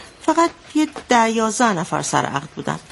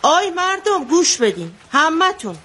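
retold shout; 6 semitones higher than usual. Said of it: "بعد داد زد".

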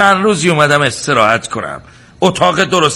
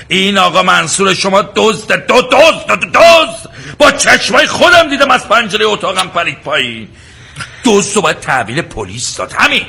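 high, loud stammering shout on دزد; furious guest stammering while accusing the servant of theft; angry accusation; 5 semitones higher, 3 dB louder than usual. Acted. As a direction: spit out the accusation, tripping over the word.

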